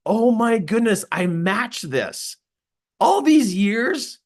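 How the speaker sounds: drawn-out, high astonishment; amazed realization. Retold or imitated. Speaker finds he matches empath traits, amazed. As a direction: stretch it out in acted amazement. Retold awakening at matching the description.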